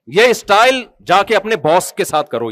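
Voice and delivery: usual speaking voice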